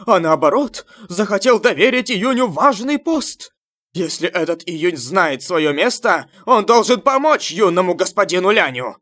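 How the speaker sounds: high, loud fanatic cheering; joyful shouting. A narrator's reading. Crowd of followers hailing the young master, shouting for joy. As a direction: shout praise over a clapping crowd. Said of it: "Некоторые люди кричали от радости".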